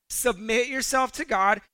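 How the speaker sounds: ordinary talk, nothing marked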